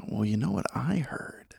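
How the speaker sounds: gossipy conspiratorial whisper. Pretend. Friend starting a rumor in a hushed gossipy whisper. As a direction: whisper it like you are sharing a secret.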